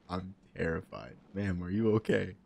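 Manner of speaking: normal talking voice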